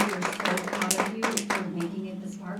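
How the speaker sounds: loud, bright emcee call to the crowd; rally the room to clap; hyped-up stage announcement; announcing applause. Real, not acted. Host calls for applause from stage.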